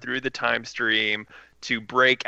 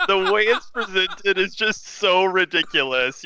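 laughing; 6 semitones higher than usual. Real, not acted. Laughing while talking.

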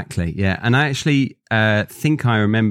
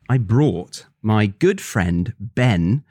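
ordinary talk

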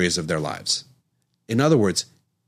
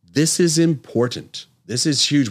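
higher, slower and louder on "This is important"; emphatic; 7 semitones higher, 4 dB louder than usual. Real, not acted. Doctor urging viewers to remember this, emphatic and slow. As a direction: hammer home that this matters. Doctor stressing key point.

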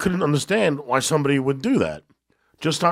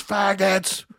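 retold drunk yell; 10 semitones higher than usual. Drunk kid yelling.

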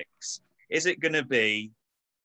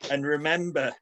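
mock team talk; 5 semitones higher than usual. Reading a halftime team talk.

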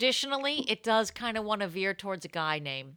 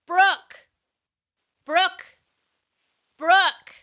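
high, loud call of a name; testing the name yell; 10 semitones higher, 8 dB louder, much slower than usual. Shown as a demonstration. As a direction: shout the name into the room. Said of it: "I just wanted to test it".

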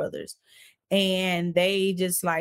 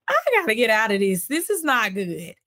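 louder, fast panicked voice; 4 semitones higher, 5 dB louder than usual. Retold or imitated. She decides to flee the marriage, alarmed and fast. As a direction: blurt it out in alarm. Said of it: "And I'm just like".

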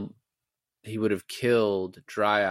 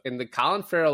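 ordinary talk

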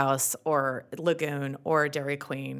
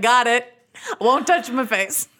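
high, loud, laughing punchline; laughing mock compliance; 7 semitones higher, 7 dB louder than usual. Wife retelling husband's deadpan joke, laughing and high. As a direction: crack up as you play along.

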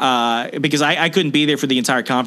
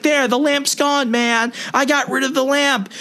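high, whiny stoner voice, much higher; mock hippie yell; 11 semitones higher than usual. Retelling the airport thief yelling at TSA in a high whine. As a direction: squeal it like an indignant burnout. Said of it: "He's like".

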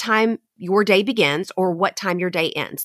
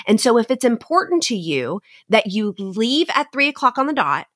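usual speaking voice

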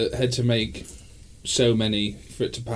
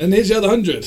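higher, louder cheeky boss; hammed-up innocent tone; cheeky team boss; 7 semitones higher, 5 dB louder than usual. Lancia team fooling inspectors.

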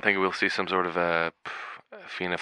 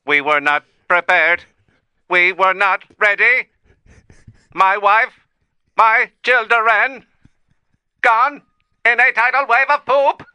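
robot voice climbing steadily higher into melodrama; raised voice; 13 semitones higher, 8 dB louder, slower than usual. Acted. AI recounting its lost family, mock-tragic raised voice. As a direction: build in pitch, phrase by halting phrase. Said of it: "I apologize for raising my voice".

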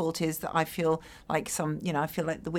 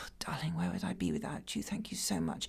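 hushed, unvoiced doting mum; a mother whispering gratitude to her child; whispered mother; 3 semitones lower, 12 dB quieter than usual. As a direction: breathe it like a clinging mother. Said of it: "Mummy ... says".

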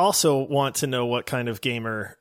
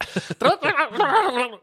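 giggling burst passed off as words; laugh as fake language; 13 semitones higher than usual. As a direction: laugh it out like a foreign phrase. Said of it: "in my native tongue means".

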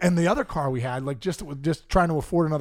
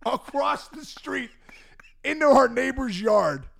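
dramatic slow; 7 semitones higher, slower than usual. Retold or imitated. Dramatic retelling.

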